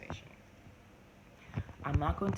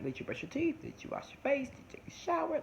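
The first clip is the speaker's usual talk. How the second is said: rising, higher with each question; nagging mom; 11 semitones higher than usual. Retold or imitated. Mom nagging her kids about brushing, in rising questions. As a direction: pester the kids one chore after another.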